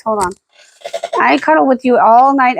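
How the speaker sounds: ordinary talk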